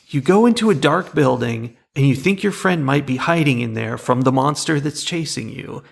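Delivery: ordinary talk, nothing marked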